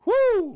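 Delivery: loud, high-pitched "woo"; big celebratory woo; excited whoop. Real, not acted. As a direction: whoop at the soaring prices. Excited reaction to rising prices.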